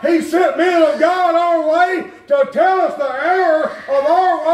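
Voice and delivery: high, slow, fervent preaching cadence; preaching at full force. Acted. Preacher urging the congregation toward obedience, fervent high preaching. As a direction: ring it out from the pulpit like a revival call.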